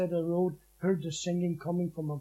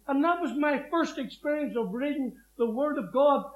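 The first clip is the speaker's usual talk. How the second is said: usual speaking voice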